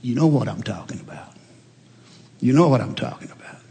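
low, soft murmur in the ear; hushed tempter, barely above a murmur; devil's whisper. Acted. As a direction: imitate the devil's sly whisper, still voiced. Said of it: "he'll whisper in your ear".

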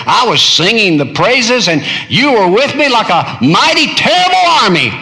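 climbing high and loud; rising exultation. Acted. Preacher voicing Jeremiah praising God's might, building to a high shout.

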